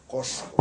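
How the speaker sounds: "Cosco" with a strong apical, sh-like S; apical sibilant. Shown as a demonstration. Explaining old Castilian S, "Cosco" with an apical sibilant. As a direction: make the S in "Cosco" a tongue-tip hiss. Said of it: "como una sibilante apical".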